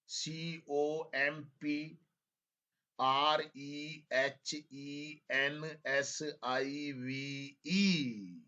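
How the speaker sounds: letters drawn out very slowly; slow spelling dictation. Shown as a demonstration. Teacher spelling comprehensive slowly for students to check. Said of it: "अब check कर लो".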